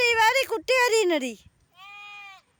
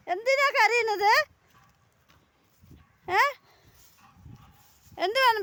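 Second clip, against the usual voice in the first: coaxing pet talk; 6 semitones higher than usual. Real, not acted. Coaxing crying pets in rain.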